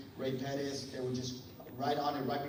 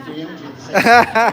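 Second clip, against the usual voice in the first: excited shout; 7 semitones higher, 23 dB louder than usual. Real, not acted. Excited punchline to audience.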